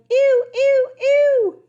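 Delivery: high, loud disgusted squeal. Acted. Princess squealing at the cow pie underfoot, loud and high. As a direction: shriek it out, grossed out.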